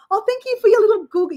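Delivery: high, cooing thanks to a pet; baby talk. Retold or imitated. Thanking her chicken for an egg in baby talk. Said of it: "make those baby noises that you do".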